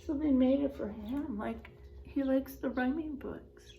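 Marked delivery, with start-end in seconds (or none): 1.4-3.7 soft breathy aside; whispered aside. Real, not acted. Teacher whispering an aside to her first graders. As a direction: lean in and whisper it.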